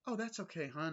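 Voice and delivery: high, soothing mock-Wendy; lightly voiced accommodating wife; imitating Wendy. Wendy reassuring Jack in a high gentle voice.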